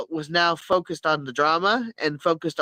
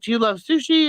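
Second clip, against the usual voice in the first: pitched up, teasing question; high mock question; 7 semitones higher than usual. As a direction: ask it high like the teasing troll. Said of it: "she's like".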